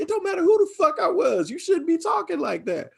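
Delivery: high scolding mother voice; high fast mom scolding. Retold or imitated. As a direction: scold like a mom laying down the law. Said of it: "She was like".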